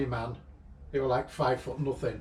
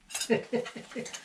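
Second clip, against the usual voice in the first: breathless giggle; 9 semitones higher, 4 dB quieter than usual. Real, not acted. Laughing at the memory.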